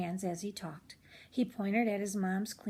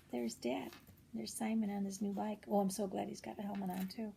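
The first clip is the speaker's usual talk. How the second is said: soft aside; 6 dB quieter than usual. Real, not acted. Narrator aside to listener.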